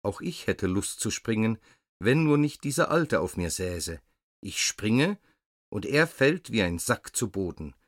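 ordinary delivery

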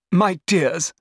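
high, excited cousin voice; exclaiming. A narrator's reading. Cousin greets the sisters, exclaiming. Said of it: "she exclaimed".

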